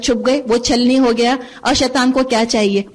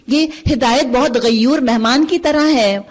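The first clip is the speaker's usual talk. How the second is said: ordinary delivery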